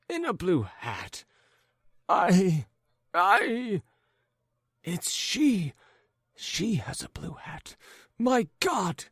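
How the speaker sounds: high, broken, stammering cry; crying out in dismay. A narrator's reading. Jealous husband recognising his wife's hat, stammering dismay. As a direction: stumble over I, I in shock. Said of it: "cried Ivan Andreyitch".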